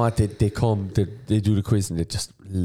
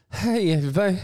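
much higher put-on host voice; mock quiz host greeting; 13 semitones higher than usual. Host pretends to open his quiz, high mock compere voice. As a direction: greet the crowd like a cheesy quizmaster. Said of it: "I'll do that".

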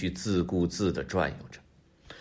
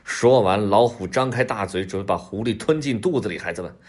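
ordinary delivery, nothing marked

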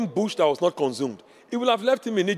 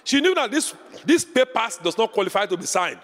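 ordinary talk

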